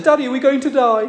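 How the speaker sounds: put-on high, small child's voice asking if they'll die; frightened little girl. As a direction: voice a scared little daughter on the plane. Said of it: "and Annie said".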